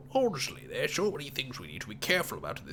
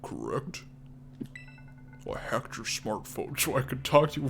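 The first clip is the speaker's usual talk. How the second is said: hacker voice. Acted. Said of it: "that was my hacker voice".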